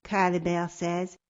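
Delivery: ordinary delivery, nothing marked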